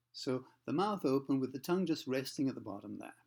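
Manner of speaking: usual speaking voice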